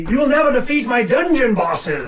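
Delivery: high loud villain boast; slow theatrical villain menace. Acted. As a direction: shout the threat like a stage villain. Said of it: "He'll say".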